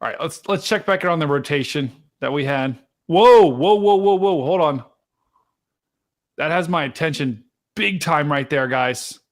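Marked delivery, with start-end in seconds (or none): 3.1-4.8 loud, higher burst of real surprise; sudden loud exclamation of alarm; startled whoa. Real, not acted. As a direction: caught off guard by the radar. Sudden rotation appears on radar.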